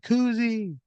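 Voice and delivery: high-pitched squealed name; high, drawn-out mock scream. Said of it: "she just screams".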